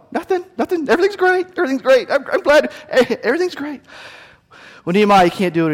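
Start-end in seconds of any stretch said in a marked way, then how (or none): 0.1-3.8 much higher, hasty forced brightness; nervous fake cheer. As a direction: cover up quickly for the boss. Employee covering with fake cheer.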